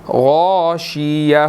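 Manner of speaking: غاشیہ slowly, with a clear ha sound at the end. Shown as a demonstration. Teacher shows the ending sound, slow and clear. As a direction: say غاشیہ slowly and let the final ha be heard. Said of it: "یہاں پر ہا کی sound آئے گی".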